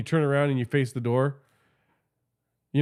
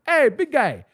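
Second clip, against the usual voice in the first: high, louder friendly call; sweet high call; 9 semitones higher, 5 dB louder, slower than usual. Retold or imitated. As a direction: say it sweetly, trying to be nice. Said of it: "I was just trying to be nice".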